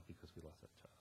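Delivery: whispered, almost no voice; hushed quote. Retold or imitated. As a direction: let it fall to a whisper. Quoting pastor about lost child.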